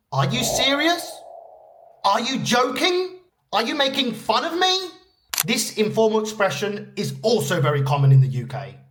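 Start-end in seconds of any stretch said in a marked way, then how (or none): 0.1-4.9 high-pitched, disbelieving questions; incredulous questioning. Acted.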